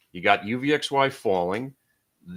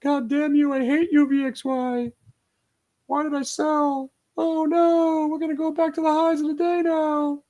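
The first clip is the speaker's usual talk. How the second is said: mock screaming shorters. Trader mocking the short sellers who are screaming. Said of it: "They're screaming".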